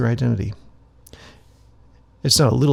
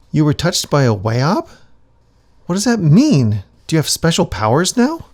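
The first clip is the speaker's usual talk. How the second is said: eager acolyte; 4 semitones higher, 7 dB louder than usual. Acted. Acolyte eagerly questions shaman.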